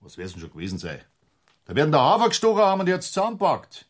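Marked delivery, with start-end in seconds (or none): 1.7-3.8 loud, high, exasperated; impatient outburst. Acted. Superior cutting the inspector off, a loud high outburst. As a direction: lose patience and blast it out.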